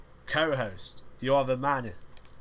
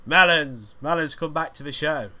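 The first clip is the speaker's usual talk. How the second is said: calling out; 6 semitones higher, 8 dB louder than usual. Acted. Calling absent co-host back.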